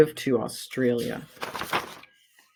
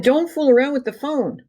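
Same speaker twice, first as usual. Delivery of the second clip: dad's warning; 9 semitones higher than usual. Acted. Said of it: "He said".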